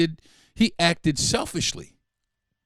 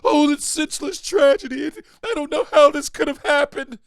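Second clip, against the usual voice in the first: high-pitched mock-grieving voice; mock lament; 9 semitones higher, 3 dB louder than usual. Podcaster mimics gun lovers bemoaning a tragedy, high mock lament. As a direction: put on a high, hammed-up voice of helpless dismay. Mocking hypocrites' grief over a shooting.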